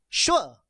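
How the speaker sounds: "sure" dropping sharply from high; exclaimed, falling "sure"; exclamation tone. Shown as a demonstration. Teacher demonstrates tone number four, exclaimed falling "sure". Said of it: "is going to be exclamation tone".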